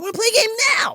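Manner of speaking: put-on high, loud kid's outburst; demanding child. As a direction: burst out as a pushy little boy. Son demanding a game.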